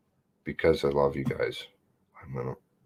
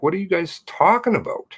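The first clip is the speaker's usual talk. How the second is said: retold disbelief; 14 semitones higher, 4 dB louder than usual. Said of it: "I'm like".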